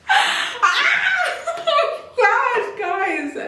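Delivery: mortified delight; embarrassed squeal. Real, not acted. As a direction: shriek then giggle. Shrieking at spicy line.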